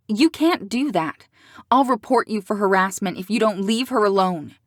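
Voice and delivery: loud, angry threat; threatening. Acted. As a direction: warn him, fired up. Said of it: "she threatened".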